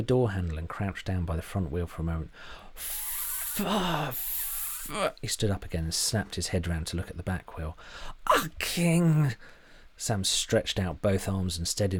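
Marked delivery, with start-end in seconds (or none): 2.7-5.1 high, breathless half-swear; stifled curse. A narrator's reading. Sam's cut-off swearing. 8.3-9.3 loud, high frustrated outburst; angry exclamation. A narrator's reading. Sam cursing at tyres.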